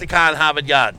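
dropped-r Boston accent. Shown as a demonstration. Co-host showing the others how, broad Boston accent. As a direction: demonstrate broad Boston, no r's. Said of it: "Like this, ready?".

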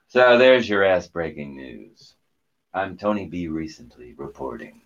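booming anchor sign-off; news anchor sign-off. Acted. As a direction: close the show like a TV anchor.

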